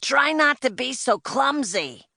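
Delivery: high-pitched bossy scolding; loud agent's telling-off. A narrator's reading. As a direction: call out a sharp reprimand, pitched well up. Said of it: "his voice even louder than his jacket".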